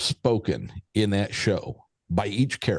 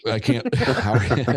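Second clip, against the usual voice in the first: laughing; much faster than usual. Acted. Letterkenny quote banter.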